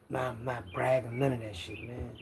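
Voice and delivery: usual speaking voice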